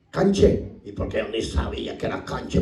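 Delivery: ordinary talk, nothing marked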